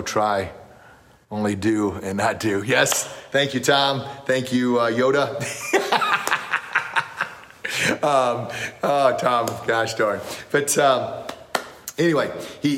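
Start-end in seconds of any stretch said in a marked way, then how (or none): 5.5-7.3 amused laugh. Real, not acted. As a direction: chuckle at the joke. Laughs at Yoda joke.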